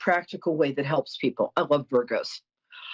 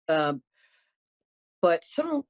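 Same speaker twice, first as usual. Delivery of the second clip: ordinary delivery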